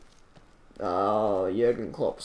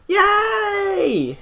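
high, loud drawn-out cheer; gleeful cheer; 9 dB louder than usual. Acted. Player cheering for crossed fingers, high loud squeal.

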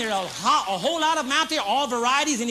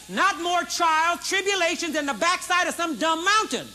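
rising preaching fervor; 9 semitones higher, 5 dB louder than usual. Acted.

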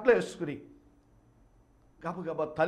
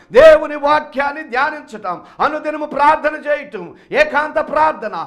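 loud, higher, driving sermon climax; preaching at full force; 10 semitones higher, 14 dB louder than usual. Acted. Preacher confronting his flock, loud and pleading. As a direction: hammer the question at the congregation.

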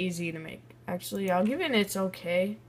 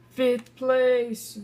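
higher, louder announcement; excited; 5 semitones higher, 5 dB louder than usual. Real, not acted. Player finding his own ranking, excited call. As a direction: call out the placing with glee.

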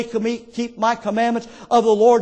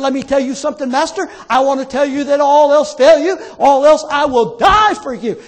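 acted heated vow, growing louder; flaming mad Peter; 2 semitones higher, 5 dB louder than usual. Peter vowing loyalty to his Master, flaming mad. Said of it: "Peter said he got flaming mad".

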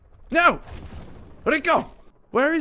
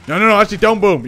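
shouting no no no at the blast, much louder; louder, higher panicked plea; 3 semitones higher, 8 dB louder, faster than usual. Real, not acted. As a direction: yell at the explosion to stop. Car about to explode nearby.

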